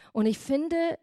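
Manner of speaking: normal talking voice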